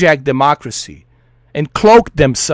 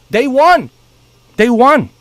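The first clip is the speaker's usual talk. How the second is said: emphatic insisting; 5 semitones higher, 4 dB louder than usual. Real, not acted. Insisting the enemy won.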